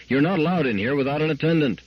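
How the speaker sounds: high, exasperated reprimand at normal volume; narrated yell held back in level; mock yell. A narrator's reading. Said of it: "he yelled".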